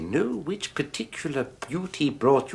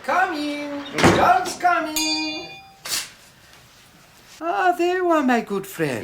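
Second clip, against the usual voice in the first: high, fawning shopkeeper's voice; oily old shopkeeper; 4 dB louder than usual. Acted. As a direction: greet the customer like an unctuous old dealer. Shopkeeper character voice.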